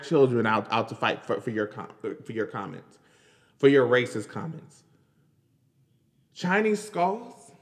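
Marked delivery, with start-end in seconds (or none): 6.3-7.5 incredulous. Real, not acted. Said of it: "incredulous repeat".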